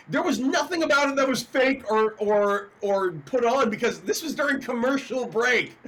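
ordinary talk

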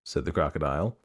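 ordinary delivery, nothing marked

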